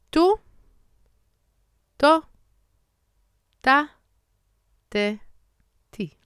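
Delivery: tu to ta te ti with little air on t; t drill. Shown as a demonstration. Teacher drilling Hebrew t syllables. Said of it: "let's do the t".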